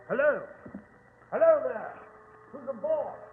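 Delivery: calling out. Acted. Watson hailing the silent boat, calling out. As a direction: call out to see if anyone is aboard.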